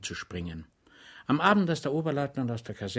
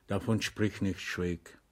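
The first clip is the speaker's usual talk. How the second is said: put-on softer, gentle refusal between old friends; 3 semitones lower, 4 dB quieter than usual. A narrator's reading. Blanik gently refusing payment from his old comrade, soft. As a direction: wave the debt away tenderly. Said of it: "sagte Blanik weich".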